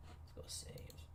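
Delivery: barely audible whispered aside; muttering to self. Real, not acted. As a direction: mumble it softly to yourself. Talking to self while navigating stats.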